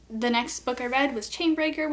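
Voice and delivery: normal talking voice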